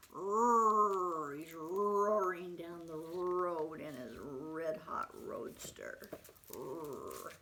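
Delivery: long engine-like R in "Roar", "roaring", "roadster"; stretched growling R starting "roaring" and "road". Shown as a demonstration. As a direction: rev the R's of "Roar" and "red" slowly. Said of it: "He's roaring down the road".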